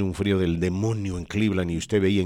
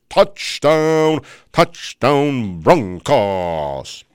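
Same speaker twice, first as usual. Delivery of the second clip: booming, high, slow announcer call building to touchdown; imitating a famous announcer's big touchdown call; Harry Kalas play-by-play; 8 semitones higher, 7 dB louder, much slower than usual. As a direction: call it like a legendary NFL narrator, drawn out and loud. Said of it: "con un estilo muy propio".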